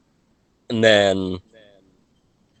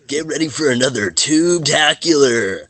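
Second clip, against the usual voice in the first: surfer dude; 7 semitones higher than usual. Acted. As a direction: hype it like a stoked surfer. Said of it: "let's do a surfer voice".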